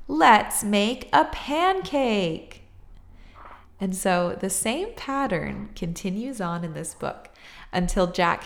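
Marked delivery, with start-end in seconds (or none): 0.1-2.6 put-on high, loud, very slow child's call; excited shout. A narrator's reading. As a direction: drag it out eagerly. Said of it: "shouted Jack".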